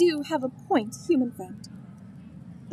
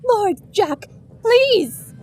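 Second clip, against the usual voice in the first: higher, louder desperate begging; loud plea; 6 semitones higher, 7 dB louder, much slower than usual. Acted. Begging Lord Jack to lend his army, loud plea. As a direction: cry out to him, pleading.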